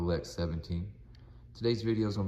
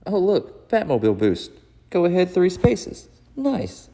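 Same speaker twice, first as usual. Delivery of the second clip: much higher, louder voice, delighted at a lucky draw; gleeful player; 12 semitones higher, 15 dB louder, slower than usual. Acted. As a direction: cheer the bonus like a kid winning. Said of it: "try to change my voice a little".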